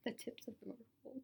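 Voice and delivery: soft unvoiced whisper; library whisper. Real, not acted. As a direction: whisper it softly. Recording in library.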